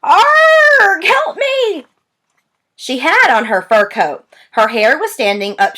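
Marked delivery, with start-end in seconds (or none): 0.0-1.0 loud, high pained yell; pained howling shout. A narrator's reading. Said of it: "Granny shouted". 1.0-1.9 high, strained cry for help; granny shrieks in pain for help; shouted plea. A narrator's reading. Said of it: "Granny shouted".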